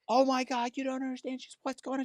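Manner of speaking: high-pitched panicked woman's voice; frantic Becky. Retold or imitated. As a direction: play the frightened girlfriend on the phone.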